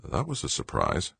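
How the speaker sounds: ordinary delivery, nothing marked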